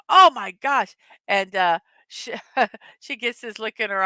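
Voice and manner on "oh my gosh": higher, louder mock-shocked oh my gosh; whole family gasping at the smell; shocked exclaim. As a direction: blurt it out in comic alarm. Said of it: "all of you go".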